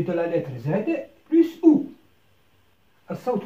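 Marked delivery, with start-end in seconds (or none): 1.3-1.9 plus ou called out much higher and louder; emphatic model sound. Shown as a demonstration. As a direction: ring out ou for the class. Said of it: "teacher sounds out syllable".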